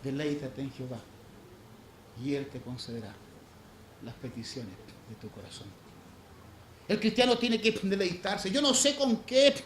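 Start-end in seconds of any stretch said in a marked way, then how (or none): 0.0-5.7 soft, low, intimate sermon voice; hushed, earnest preaching to the congregation. Acted. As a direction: preach gently, almost confiding.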